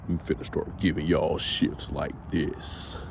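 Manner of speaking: slow, low, soft baritone; put-on baritone. Shown as a demonstration. Host joking about his new shift voice, slow deep baritone. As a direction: drop into a mock deep voice and take it slowly. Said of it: "back the baritone".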